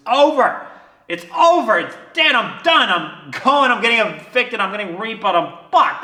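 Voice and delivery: loud, high outburst easing down; reenacted frantic despair, starting at a peak; retold panic. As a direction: spiral through the disasters in short bursts. Retold panicked thoughts of total failure.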